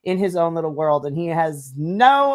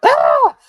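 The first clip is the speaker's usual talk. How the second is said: high startled yelp; startled shriek; 11 dB louder than usual. Acted. The startled cook shrieking at the sudden greeting. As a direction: jump out of your skin with a cry.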